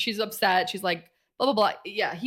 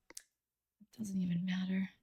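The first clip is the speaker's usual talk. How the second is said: hushed, breathy whisper; whispered evasion to Mina; 3 dB quieter, slower than usual. Retold or imitated. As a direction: breathe it out, barely voiced. God dodges question, whispering.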